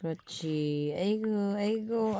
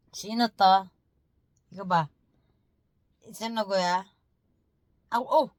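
ordinary talk

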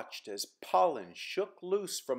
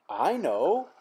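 louder, higher burst of an idea; exclaiming; 6 semitones higher, 7 dB louder than usual. Acted. Simon struck by an idea, louder and higher. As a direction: pop out the sudden idea. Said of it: "exclaimed Simon".

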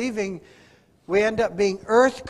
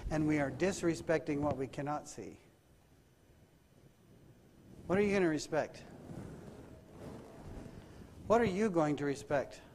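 soft, lowered, searching questions; hushed preaching; 4 semitones lower, 8 dB quieter than usual. Acted. Preacher challenging congregation about this week, hushed solemn preaching. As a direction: bring it right down and let each question sit.

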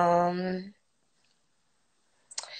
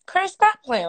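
playful scold; 6 semitones higher, 4 dB louder than usual. Real, not acted. Scolds viewer Chris.

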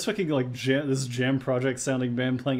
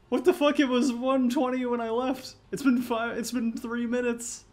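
high, incredulous squawk of protest; acted outrage, pitched way up; high-pitched disbelief; 11 semitones higher than usual. Acted outrage at quick price change.

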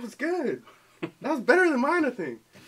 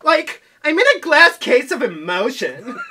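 put-on high, loud laughing Valley Girl; 10 semitones higher, 8 dB louder than usual. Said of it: "do Valley Girl".

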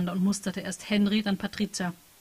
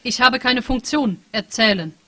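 lightly voiced machine, a touch higher and louder; AI chat program; 4 semitones higher, 7 dB louder, slower than usual. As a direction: read it like an emotionless program reporting data. AI program answers. (leicht angedeutete Maschinenstimme, sachlich und gefühllos)

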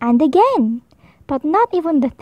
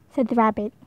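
usual speaking voice

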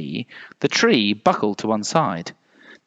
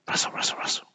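soft, whispery sound words; very quiet rustle; hushed rustling; 10 dB quieter, faster than usual. A narrator's reading. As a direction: whisper it like leaves stirring.

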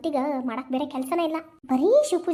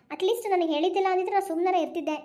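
ordinary delivery

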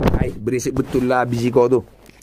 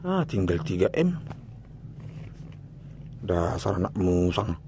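defeated mutter; 6 semitones lower, 9 dB quieter than usual. Real, not acted. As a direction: sigh it out beaten. Defeated after losing money. (gumaman pasrah)

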